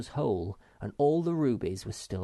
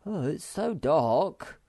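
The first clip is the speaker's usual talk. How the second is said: uneasy; 7 semitones higher, 5 dB louder, slower than usual. A narrator's reading. Moomin Troll nervous in hold.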